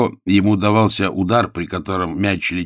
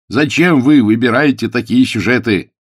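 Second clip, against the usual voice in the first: louder, higher exclaimed question; exclaiming; 5 semitones higher, 8 dB louder than usual. A narrator's reading. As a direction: keep crying out in protest. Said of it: "воскликнул Пилчер".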